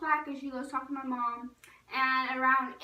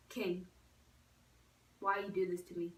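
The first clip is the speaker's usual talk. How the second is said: low-key mock reproach; 4 semitones lower, 4 dB quieter than usual. Pleading with kennelled dog.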